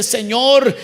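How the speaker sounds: prayer that swells to a loud cry; fervent prayer rising to a shout; pleading prayer. Retold or imitated.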